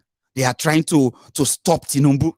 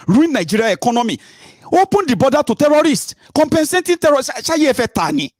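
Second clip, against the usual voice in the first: angry rant; 9 semitones higher than usual. Real, not acted. Ranting at critics of him.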